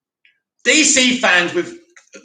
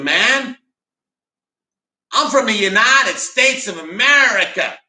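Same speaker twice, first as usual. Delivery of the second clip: mock American; 3 semitones higher than usual. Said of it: "people in this chat saying".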